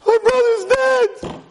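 high, loud hysterical shriek; hammed-up panicked wail; screaming. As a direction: scream in horrified vindication.

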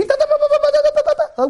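high, far-off garbled scream imitation; hammed-up shriek of a lady; mock screaming lady. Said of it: "a lady scream like crazy".

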